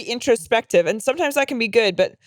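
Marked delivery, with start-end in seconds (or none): none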